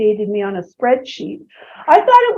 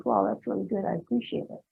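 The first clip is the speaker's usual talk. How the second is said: lower, softer, touched; soft grateful husband; 5 semitones lower, 13 dB quieter than usual. Retold or imitated. Husband thanking wife, softly and lower. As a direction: say it quietly, genuinely moved. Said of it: "He goes".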